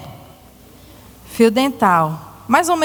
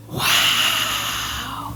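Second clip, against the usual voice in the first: breathy hushed wow; mock wow; 7 dB quieter than usual. Teacher showing the grown penguin, hushed amazed wow. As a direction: gasp it in amazement for the kids.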